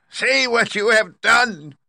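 slow, very high loud shriek; witch's scream. A narrator's reading. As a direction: scream it out as the witch, drawing out each word. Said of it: "she screamed".

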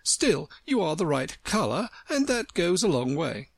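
high, prissy, chatty tone; condescending and proper; prim flower voice. Acted.

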